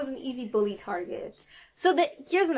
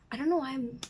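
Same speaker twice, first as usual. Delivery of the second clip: ordinary talk, nothing marked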